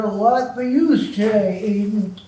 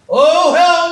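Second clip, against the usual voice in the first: loud, higher "oh hell no"; loud outburst; 4 semitones higher, 7 dB louder than usual. Acted. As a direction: blurt it out in alarm. Panics at the fed.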